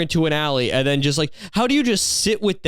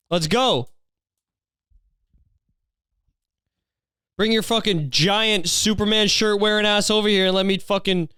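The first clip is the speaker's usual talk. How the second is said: higher-pitched mock yelling, crude insults; pretend taunting yell; 5 semitones higher than usual. Vlogger keeps imagining yelling back at the man, mock taunting yell. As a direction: taunt him like you're shouting across a road. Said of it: "Should've just yelled at him".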